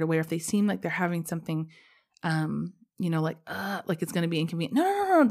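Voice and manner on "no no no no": much higher and louder, eager mock protest; hurried refusal. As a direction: wave it off fast, overeager. Quickly refusing help, acted.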